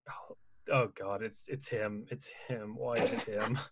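soft, low, mock-anguished lament; mock despair. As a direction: quietly wail it to yourself in pretend misery. Acts dismayed reaction to song.

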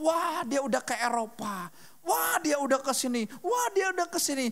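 a hint of a wowed, envious scroller; mock the jealous gasp at friends' trips. Mimicking a youth envying friends' Instagram trips, lightly wowed.

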